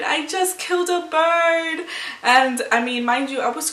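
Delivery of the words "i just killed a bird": high, half-sung wail of guilt; mock-sobbing the words up high; retold wail. Telling friends she killed a bird, sung wail. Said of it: "was saying it really loudly".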